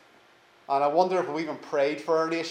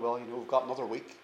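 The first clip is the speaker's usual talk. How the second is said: casual mock shrug; 5 semitones lower, 9 dB quieter, faster than usual. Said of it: "He's not sitting there going".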